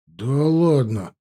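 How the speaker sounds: slow, drawn-out disbelief; amazed, lingering exclamation; stunned. A narrator's reading. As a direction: stretch it out in amazement. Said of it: "обалдел я".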